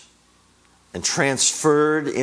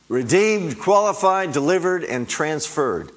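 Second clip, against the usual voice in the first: higher and louder, each word hammered out; fervent loud preacher's list; rising preached cry; 7 semitones higher, 5 dB louder than usual. Real, not acted. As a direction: proclaim each word like a victory shout, easing on the last. Same list repeated, preached with force.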